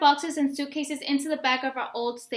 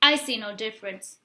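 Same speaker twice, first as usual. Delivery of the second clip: ordinary delivery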